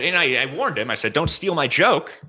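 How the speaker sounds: ordinary talk, nothing marked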